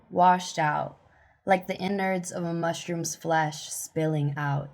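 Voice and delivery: spoken-word reading with punched line openings; measured, emphatic poetry reading; poem recital. Acted. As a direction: recite firmly, leaning on each season.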